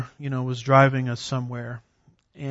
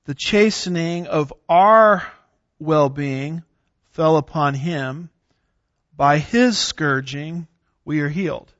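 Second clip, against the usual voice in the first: slow, weighty, our stressed and falling; emphatic scripture reading; 5 dB louder than usual. Shown as a demonstration. Teacher finishing the Isaiah passage, slow and emphatic. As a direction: lay weight on our and on healed. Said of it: "from the way I emphasize some of those".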